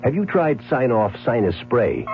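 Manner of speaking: usual speaking voice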